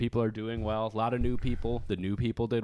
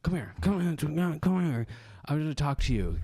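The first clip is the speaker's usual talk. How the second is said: put-on higher, insistent drunk voice calling him over; imitating drunk lady; 7 semitones higher than usual. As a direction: lightly mimic a pushy drunk woman beckoning.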